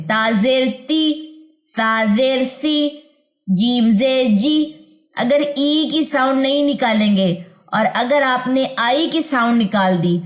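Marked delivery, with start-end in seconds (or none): 0.1-4.7 تازر تی slowly, each ending in a clear ee; ee sound drill. Shown as a demonstration. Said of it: "ای کی sound آئے آخر میں".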